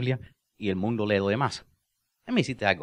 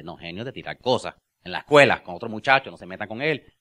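usual speaking voice

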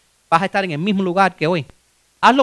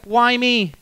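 mock baby wailing "why me"; whiny baby; 3 semitones higher, much slower than usual. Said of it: "como un baby".

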